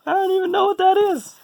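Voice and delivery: laughing disbelief. Real, not acted. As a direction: squeak it out mid-laugh. Laughing at himself.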